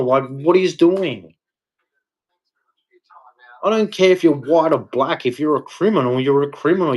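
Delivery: ordinary delivery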